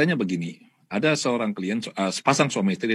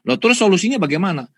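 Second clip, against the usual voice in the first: puzzled father; 7 semitones higher, 5 dB louder, faster than usual. Retold or imitated. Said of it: "Terus dia bilang".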